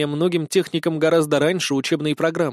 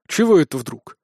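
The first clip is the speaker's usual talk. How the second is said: mildly surprised question; a touch of puzzlement. A narrator's reading. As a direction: ask in understated surprise. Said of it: "удивился Толик".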